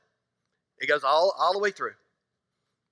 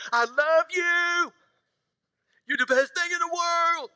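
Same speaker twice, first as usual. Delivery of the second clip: much higher, slow lovestruck newlywed; mock lovestruck newlywed; 11 semitones higher, slower than usual. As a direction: coo it slowly like a smitten new couple. Newly-weds voiced sweetly.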